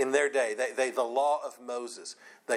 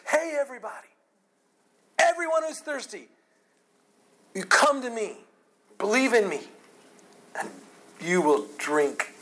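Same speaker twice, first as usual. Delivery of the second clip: much higher, loud calling out to the crowd; Jesus calling out; 8 semitones higher, 4 dB louder than usual. Retold or imitated. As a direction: cry out an invitation like a preacher to the crowd. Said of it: "can't you just hear Jesus saying".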